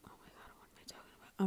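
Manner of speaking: fast embarrassed whisper. Real, not acted. Podcaster losing her place, whispering to herself. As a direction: hush it to yourself, quickly.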